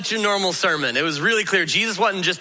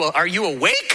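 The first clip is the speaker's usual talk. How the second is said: ordinary talk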